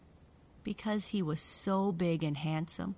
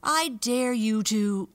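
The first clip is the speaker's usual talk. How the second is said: loud, slow, higher defiant taunt; louder taunting dare; 5 semitones higher, 10 dB louder, slower than usual. Acted. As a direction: throw the dare harder, slowly. Dare repeated more forcefully.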